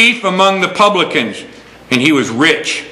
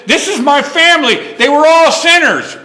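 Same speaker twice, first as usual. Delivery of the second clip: excited; 11 semitones higher than usual. Retold or imitated. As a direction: proudly proclaim it through a laugh. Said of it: "and said".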